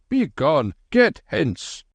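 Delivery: high, angry farmer's yell; an angry farmer shouting at beggars. A narrator's reading. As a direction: drive the beggars off. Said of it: "shouted the farmer".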